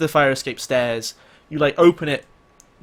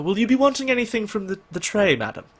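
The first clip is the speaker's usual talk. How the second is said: butler; 7 semitones higher than usual. Acted. GM voices butler offering tray.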